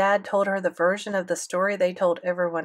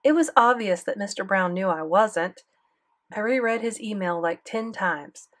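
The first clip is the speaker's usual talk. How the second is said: ordinary delivery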